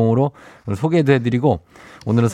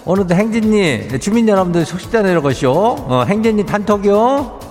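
Chungcheong dialect; 6 semitones higher than usual. Acted. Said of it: "행진리 이장인데요".

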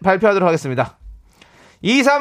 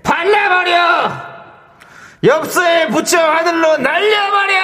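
high hyped shout; 9 semitones higher, slower than usual. Acted. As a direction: yell it out high and slow. Shouted quiz lyric on air.

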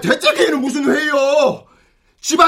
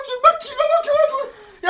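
much higher, strained cry of disbelief; exasperated cry; 10 semitones higher than usual. Acted. Father cries out in disbelief at his son, exasperated. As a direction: choke on the outrage.

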